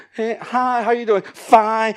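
high, loud, phony chirp; fake-cheery greeting. Preacher acting churchgoers at life group, fake-cheery greeting. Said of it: "We're just going through the motions".

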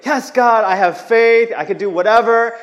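high, loud, eager faith; super excited. Retold or imitated. Young believer on a good day, loud and high. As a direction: burst out with giddy joy. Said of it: "like, super excited".

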